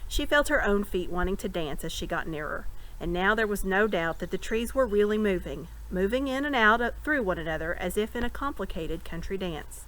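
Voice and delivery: plain reading voice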